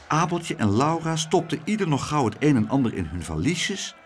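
ordinary delivery, nothing marked

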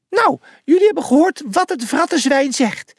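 high little mouse voice calling out; rally them in a tiny voice; squeaky call. A narrator's reading. The little mouse rallies the team, high and squeaky. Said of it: "riep Pliny".

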